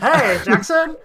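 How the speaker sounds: high-pitched mock greeting; imitated greeting. Co-host pretending to be an online player, high greeting.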